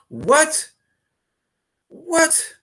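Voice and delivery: very high, loud startled cry; shocked what. Real, not acted. Host learning he's been live for half an hour, shocked. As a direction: caught off guard, jumping up.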